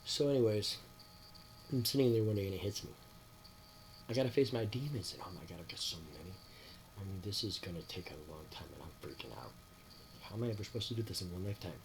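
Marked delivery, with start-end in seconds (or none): 5.1-11.7 fast, dropping, hushed worried rush; mock panic. Retelling his panic over facing his demons, hushed fast worry. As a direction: spill the worry out fast and low.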